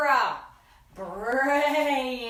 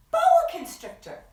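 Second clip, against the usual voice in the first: high fast excited exclaim; 7 dB louder, much faster than usual. Real, not acted. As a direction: say it with big excitement. Excited repeat of name.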